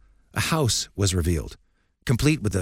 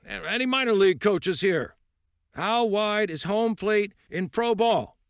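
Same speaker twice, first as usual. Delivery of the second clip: put-on lightly raised, gruff old coach lecturing; old coach's voice; 13 semitones higher, slower than usual. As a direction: speak as a stern old baseball coach.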